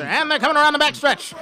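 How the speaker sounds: put-on old-timey race caller, excited and high; 1930s race announcer. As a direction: call it like a vintage horse race announcer. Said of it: "like an old nineteen thirties race announcer".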